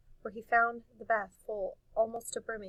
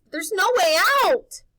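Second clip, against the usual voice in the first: high, loud hopeless cry; despairing wail; 11 semitones higher, 17 dB louder than usual. Acted. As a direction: wail that there is no escape. Wendell despairing.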